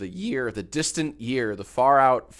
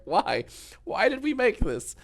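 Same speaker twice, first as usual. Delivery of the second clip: mock bewildered; 10 semitones higher than usual. Mock reaction to AI videos.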